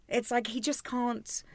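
ordinary talk

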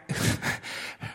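breathy, sheepish little laugh; soft laugh. Real, not acted. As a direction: laugh at yourself mid-sentence. Laughs at own stumble.

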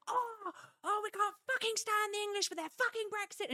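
high-pitched mock tirade; retold exasperated rant, high and worked up; mock outburst. French woman's outburst, acted.

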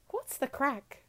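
reluctant attempt at an Irish accent; half-hearted Irish accent. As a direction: try Irish with very little vim. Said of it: "it had no energy in it".

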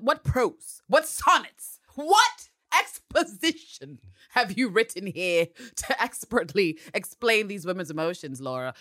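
high, loud, theatrical mock praise; sarcastic awe. Podcaster mocks writer Laura Neal's script, grandly sarcastic. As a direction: declaim it grandly, dripping with sarcasm.

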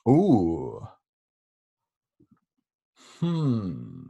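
ordinary talk, nothing marked

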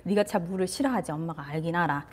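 put-on calm a scared toddler gently; soft tender mother; soft soothing. Shown as a demonstration. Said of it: "딱 그렇게".

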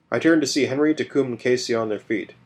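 ordinary delivery

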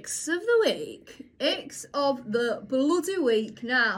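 slow, drawn-out mock jingle intro; segment announcement. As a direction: announce it with long stretched words.

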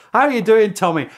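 loud friendly call across the lines; called greeting. Retold or imitated. German soldiers calling to the British, loud friendly greeting. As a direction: shout it across no man's land. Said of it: "people who say".

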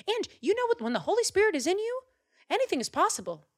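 high and fast with conviction; fervent high burst. Acted. David convincing Saul, high fervent burst. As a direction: preach it with fire.

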